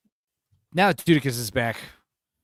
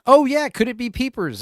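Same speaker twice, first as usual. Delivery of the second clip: mock surprise; 9 semitones higher, 7 dB louder than usual. Sarcastic mock realization.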